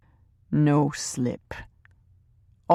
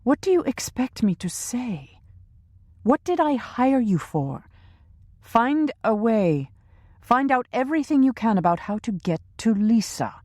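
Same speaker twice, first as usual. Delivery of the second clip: higher, imperious female voice; cold female boss; 7 semitones higher than usual. A narrator's reading. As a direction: demand it coldly from above. Teresa voiced by narrator.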